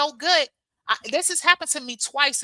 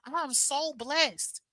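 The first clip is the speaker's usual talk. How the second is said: slow, soft, dreamy wonder; dreamy thought; 3 semitones higher, 7 dB quieter, much slower than usual. Retold or imitated. Voicing her inner thought in soft, slow wonder. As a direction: savor each word in awe. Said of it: "all I be thinking about is".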